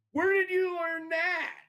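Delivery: mock high-pitched incredulous yell; astonished cab driver. Cab driver to singing kindergartner, astonished.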